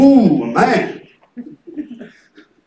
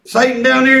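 usual speaking voice

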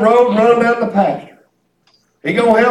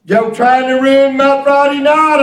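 usual speaking voice

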